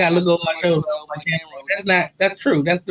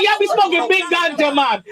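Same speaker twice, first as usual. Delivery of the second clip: higher, put-on Jamaican accent; 4 semitones higher than usual. Teasing a Jamaican man about ganja, mock Jamaican accent. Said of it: "I hit him with a Jamaican accent".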